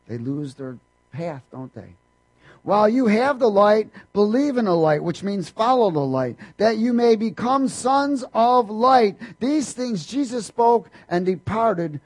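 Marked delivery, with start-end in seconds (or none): 2.6-12.0 loud, high ringing scripture; preaching at full force. Acted. Preacher proclaiming the verse loudly to the church. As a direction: proclaim the light to the crowd.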